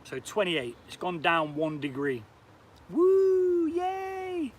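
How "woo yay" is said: a high whooping cheer, louder on the woo; excited cheer. Real, not acted. Vlogger cheers the temperature drop, high whooping cheer. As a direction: whoop with real joy, high and bright. Celebrating temperature dropping a degree.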